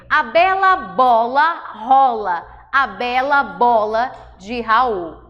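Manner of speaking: slow, deliberate reading of the line; slow example reading. Shown as a demonstration. Teacher to class, slow deliberate reading. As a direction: read it slowly so the vowels show. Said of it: "teacher reading example slowly".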